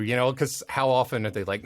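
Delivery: ordinary talk, nothing marked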